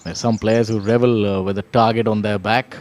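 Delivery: live golf play-by-play, conversational; golf commentator. Real, not acted. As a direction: call the putt like a TV golf commentator.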